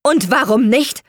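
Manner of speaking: high, loud heated retort; agitated. A narrator's reading. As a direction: throw the question back indignantly. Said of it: "fragte Leonard aufgebracht". (aufgebracht, laut und hoch)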